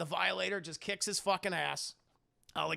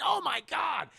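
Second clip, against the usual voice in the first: shocked cry; 9 semitones higher than usual. Retold or imitated. Shocked reaction.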